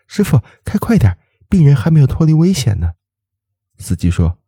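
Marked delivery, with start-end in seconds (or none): 0.1-2.9 high, pressing plea to hurry; anxious urging. A narrator's reading. Doctor pressing the ambulance driver, anxious urging. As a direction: urge the driver on, worried. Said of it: "他着急地对司机说".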